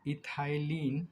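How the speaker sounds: Ethylene drawn out slowly, syllable by syllable; slow dictation. Shown as a demonstration. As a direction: spell out ethylene slowly for notes. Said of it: "इसका नाम जो होता है वो होता है". (धीरे-धीरे बोलकर लिखवाना)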